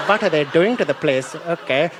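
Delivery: put-on comic Indian accent, dismayed. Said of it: "The Indians are like".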